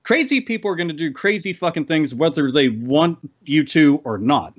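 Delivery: ordinary talk, nothing marked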